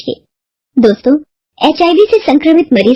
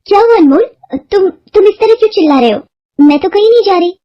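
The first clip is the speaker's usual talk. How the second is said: much higher, startled, worried voice; alarmed wife; 9 semitones higher than usual. A narrator's reading. Wife to husband woken screaming, startled and worried. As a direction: rush to ask what's wrong.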